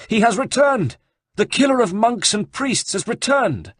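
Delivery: high, loud thundering proclamation. Acted. Abbot warns the monks, loud and thundering. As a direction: thunder it to the flock. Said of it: "in a voice of thunder".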